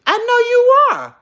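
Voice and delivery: high, loud delighted squeal; excited squeal. Real, not acted. Hyping up Cindy's looks, a loud high squeal.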